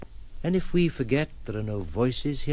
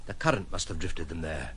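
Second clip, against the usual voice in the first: normal talking voice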